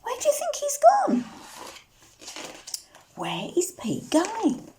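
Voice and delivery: usual speaking voice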